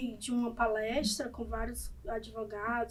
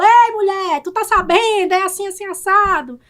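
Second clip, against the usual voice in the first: louder, higher, chatty address to followers; lively call-out; 5 semitones higher, 7 dB louder than usual. Shown as a demonstration. Lawyer hailing her followers, bright and louder. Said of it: "Então eu falo".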